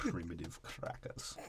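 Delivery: soft, slow whispered "Primitive crackers". Acted. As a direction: breathe it as a sneaky aside. Mock whispered insult.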